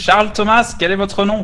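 loud mock séance questions; calling the ghost. (appel solennel au fantôme)

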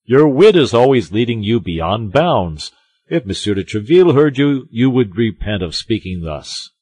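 loud, scolding musketeer; reproving Porthos. A narrator's reading. Porthos warns Aramis about his loose wit, reproving. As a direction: warn a friend forcefully.

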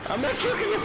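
very high, loud panicked squeak; squeaky high voice. Real, not acted. Squeaky chase taunt.

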